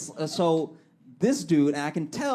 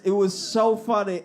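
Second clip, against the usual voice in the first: usual speaking voice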